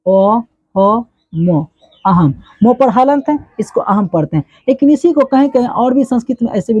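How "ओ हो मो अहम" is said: ओ, हो, मो spelled out slowly, one syllable at a time; teacher spelling out a word syllable by syllable; sounding out aham. Shown as a demonstration. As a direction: build अहम slowly from its letters ओ, हो, मो. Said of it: "जैसे हम लोग लिखते हैं अहम".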